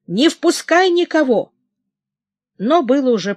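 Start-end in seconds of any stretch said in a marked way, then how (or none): 0.1-1.5 высокий отчаянный окрик; выкрикнуть срочный запрет; крик. A narrator's reading. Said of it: "закричал Телиньи". (крикнуть товарищу повыше, предостерегая его)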